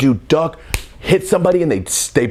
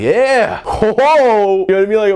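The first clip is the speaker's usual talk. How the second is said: high and loud whoa; loud, high excited whoa; juiced; 10 semitones higher, 5 dB louder than usual. Retold or imitated. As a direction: let the thrill burst out. Juiced at the party with cup.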